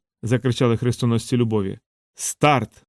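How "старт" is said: ordinary delivery, nothing marked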